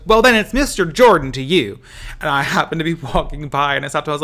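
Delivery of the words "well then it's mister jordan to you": higher and louder, haughty camp; mock offended diva. Leslie Jordan snubs the stranger, sassy camp reply. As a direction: put him in his place with sass. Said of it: "stops in his tracks and goes".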